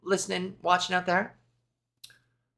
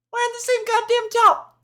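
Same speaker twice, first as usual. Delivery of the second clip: mock exasperated voice. Mock repeat of her own line.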